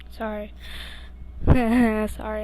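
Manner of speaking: plain reading voice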